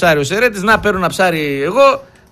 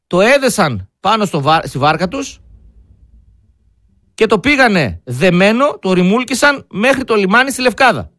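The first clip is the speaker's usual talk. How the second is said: slow, measured news-style report; now tell the real story gravely; earnest radio report after the joke; serious news telling; 2 semitones higher than usual. Acted.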